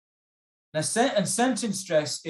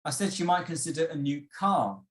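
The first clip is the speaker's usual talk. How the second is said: "car" held long at the end; emphasize "car" rather than "new"; stress on car. Shown as a demonstration. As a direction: save the weight for the final word "car". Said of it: "if I emphasize the word car instead".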